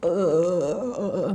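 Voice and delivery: mock stuttering 'uh'; drawn-out wavering filler noise; imitated stammering. Shown as a demonstration. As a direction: act out fumbling for words. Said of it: "I'm still stammering".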